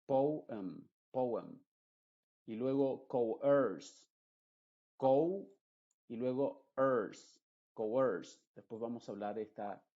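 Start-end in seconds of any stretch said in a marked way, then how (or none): 0.0-1.6 "Poem, poem" with the o and e kept apart; teacher repeating the split vowels; po then em. Shown as a demonstration. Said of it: "Po primero y luego em". 3.1-8.4 "coerce" broken into co and erse, slowly; slow spelled-out demonstration; syllable by syllable. Shown as a demonstration. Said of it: "se están pronunciando de forma independiente".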